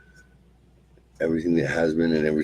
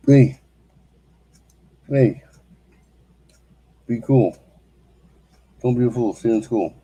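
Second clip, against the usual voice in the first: much higher and louder silly sing-song voice; goofy cartoon voice; 7 semitones higher, 6 dB louder than usual. Vlogger suddenly breaking into a silly mascot voice. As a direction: bark it out like a cheesy mascot.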